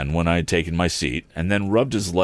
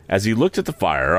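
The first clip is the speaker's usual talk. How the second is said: usual speaking voice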